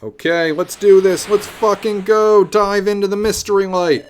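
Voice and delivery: loud, high battle cry to charge in; hyped, shouted go-for-it; rallying yell. Real, not acted. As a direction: psych yourself up at full voice. Psyching up to dive in.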